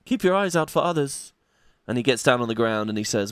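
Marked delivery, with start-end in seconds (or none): 0.1-1.3 high mage woman voice. Acted. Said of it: "She says".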